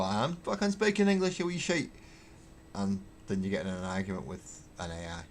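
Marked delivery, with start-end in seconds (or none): 0.0-1.9 much higher, indignant outburst; a hammed-up angry Glaswegian retort; mock furious Scot. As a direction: spit it back at the machine, outraged. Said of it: "And you'll go".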